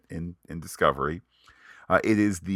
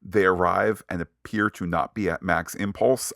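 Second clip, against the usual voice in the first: usual speaking voice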